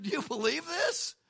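high-pitched, rising disbelief; amazed. Retold or imitated. Preacher voicing Paul amazed at his calling, incredulous. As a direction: marvel aloud, pitch climbing.

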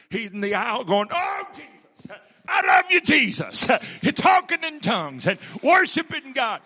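high, loud shouted retelling; full-force preaching climax. Real, not acted. Preacher telling of a father worshipping aloud, shouted high. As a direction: lift the room with it.

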